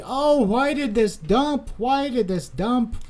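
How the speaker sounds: high, slow, puzzled mock voice; mock confused trader. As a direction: play the baffled crowd asking why. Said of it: "so you can say".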